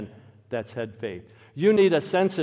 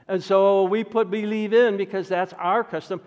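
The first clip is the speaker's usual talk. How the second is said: ordinary talk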